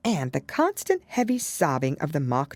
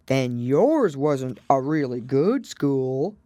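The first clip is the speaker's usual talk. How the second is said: slow, pleased turtle voice; relieved turtle; 4 dB louder, slower than usual. A narrator's reading. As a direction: sound relieved and a touch smug. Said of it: "in a tone of great relief".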